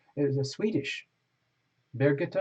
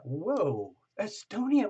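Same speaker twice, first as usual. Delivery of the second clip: surprised; 5 semitones higher, slower than usual. Real, not acted. Surprised at viewer from Estonia.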